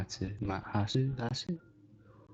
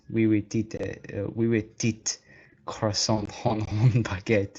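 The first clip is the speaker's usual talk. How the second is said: mock French; slower than usual. Streamer repeating joke French for a friend, mock French accent. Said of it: "Some really good French you got there".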